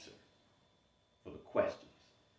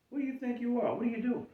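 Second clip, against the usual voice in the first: much higher, disbelieving taunt; incredulous mockery; faster than usual. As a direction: scoff in disbelief. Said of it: "imposter syndrome gives you those questions".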